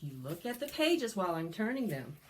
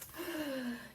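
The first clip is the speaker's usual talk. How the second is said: mock gasp; 8 semitones higher, 3 dB quieter than usual. Gasp at fly.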